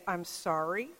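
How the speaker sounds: un "I'm sorry" más bajo y suave; soft apology. Retold or imitated. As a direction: decir "I'm sorry" suave y bajito, ya sin enojo. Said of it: "he could say".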